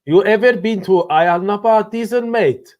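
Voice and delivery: high-pitched put-on Cypriot accent. Shown as a demonstration. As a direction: ask it in a hammed-up Cypriot accent. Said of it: "do it in a Cypriot accent".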